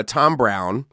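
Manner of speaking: ordinary delivery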